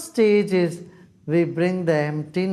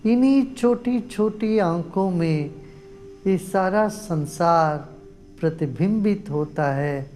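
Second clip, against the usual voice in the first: guided meditation; faster than usual. Real, not acted. Teacher guides group to close eyes and breathe, guided meditation. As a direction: lead them gently into stillness, drawing out phrase endings.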